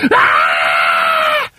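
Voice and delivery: loud startled scream. A narrator's reading. Wife feels something slimy in bed, loud scream. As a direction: cry out in fright. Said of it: "She screamed".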